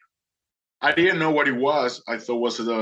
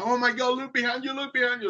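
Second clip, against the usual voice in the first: very high-pitched excited imitation; astonished alert; 12 semitones higher than usual. Boatman alerting rescued swimmer, astonished alert.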